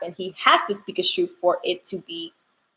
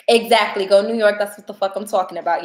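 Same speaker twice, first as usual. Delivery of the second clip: ordinary talk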